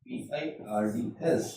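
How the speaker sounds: slow, soft spelling of birds; spelling out slowly. Shown as a demonstration. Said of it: "this is birds".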